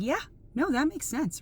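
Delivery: agreeing. Retold or imitated. Acts out own agreeing reaction.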